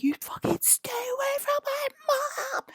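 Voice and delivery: imitated yell at a dragon; retold yell. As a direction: shriek it at the dragon. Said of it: "yelling at the dragon".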